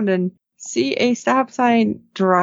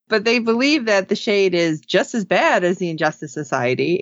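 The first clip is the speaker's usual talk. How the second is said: ordinary talk, nothing marked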